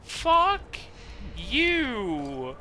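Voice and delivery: high furious curse; angry yelling. Real, not acted. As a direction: scream it at the screen. Player cursing the game.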